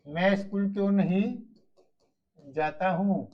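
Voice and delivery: slow, spaced dictation pace. Shown as a demonstration. Teacher dictating to pupils, slowly and clearly. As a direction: spell it out slowly for the class to write. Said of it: "teacher repeats it slowly to dictate".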